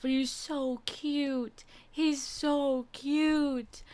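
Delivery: high, very slow adoring coo; gushing. Real, not acted. Looking at the kitten's photo, slow high adoring coo. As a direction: melt over how cute he is.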